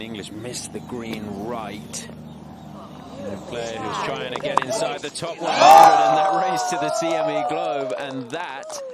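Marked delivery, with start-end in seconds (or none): 3.2-8.8 loud rising birdie call; excited shout. Real, not acted. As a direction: call the putt at full voice. Commentator calls a birdie putt.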